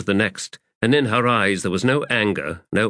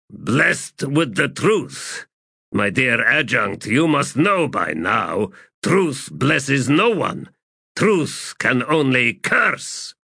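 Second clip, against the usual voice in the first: slow, bitter, haunted man; tormented septarch; 6 semitones higher, slower than usual. Acted. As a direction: let each word drag with torment. Banascar speaks bitterly.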